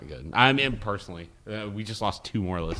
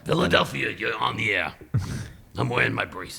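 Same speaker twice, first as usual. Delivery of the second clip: radio host voice; 2 semitones higher than usual. Retold or imitated. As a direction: play it like a call-in show host. Acting out a radio call-in scene.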